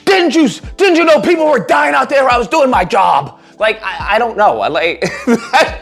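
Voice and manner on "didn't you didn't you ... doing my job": high, hammed-up outraged rant; mocking Superman yelling at Lois; mock yelling hero.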